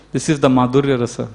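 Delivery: ordinary talk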